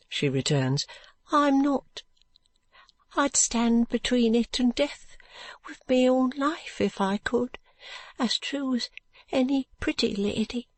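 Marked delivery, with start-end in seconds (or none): 1.3-10.7 higher, pleading brickmaker's wife; higher voice of a poor mother protesting her devotion; earnest poor mother. Acted. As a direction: insist on her love for the baby. Brickmaker's wife defends her love.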